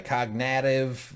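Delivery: put-on cognitive drawn out slowly in a Boston accent, kahg-na-tiv. Co-host tries the Boston way of saying it, a slow Boston accent. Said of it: "man, that Boston accent".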